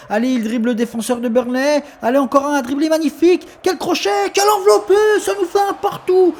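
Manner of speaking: high, shouted goal call; excited sports commentary. Acted. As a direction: scream the equaliser like a commentator.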